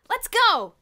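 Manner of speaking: very high, loud eager shout; cry out. A narrator's reading. Bruno throwing on his bathrobe, shouting loudly. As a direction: yell it like a rallying call. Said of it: "he cried".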